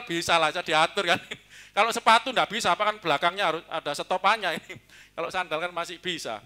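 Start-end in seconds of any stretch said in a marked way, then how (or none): none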